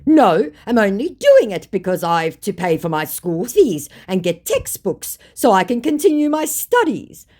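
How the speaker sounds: loud, high, pleading excuse; mock scammer. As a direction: plead for sympathy mockingly, climbing in pitch. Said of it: "one step away from saying".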